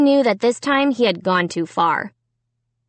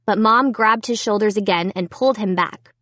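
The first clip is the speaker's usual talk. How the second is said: plain reading voice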